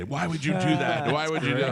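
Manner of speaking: imitated mad wife. Wife scolding the prankster husband, mock fury. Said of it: "She's still mad at me".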